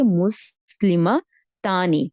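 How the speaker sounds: مسلمہ stretched out very slowly, syllable by syllable, then تانی; slow model word. Shown as a demonstration. Teacher to her students, slowly spelling out the answer. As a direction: draw مسلمہ out slowly for the class. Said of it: "کون سا لفظ استعمال کریں گے".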